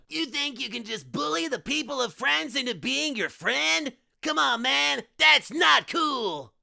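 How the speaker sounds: cheesy cartoon cat mascot, disapproving; cartoon mascot scolding. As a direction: scold like a kids' show hero. Cool Cat lecturing the monster.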